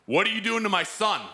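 a father catching someone hurting his son; angry father. Retold or imitated. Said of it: "I hear this voice".

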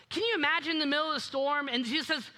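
ordinary talk, nothing marked

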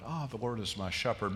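soft, low, put-on piety; sugary, hushed reverence; mock sweet. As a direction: say it gently, like a sweet platitude. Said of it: "that sounds so sweet".

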